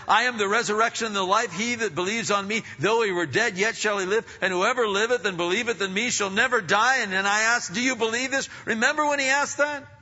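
high, loud, driving preacher's cry; full-force preaching. Acted. Preacher thundering to the congregation about creation shaking. Preacher surges, quoting Jesus.

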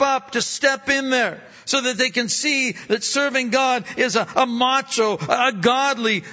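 rally the men, build it up; booming motivational speech. Acted. Preaching at full force.

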